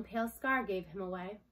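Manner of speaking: ordinary delivery, nothing marked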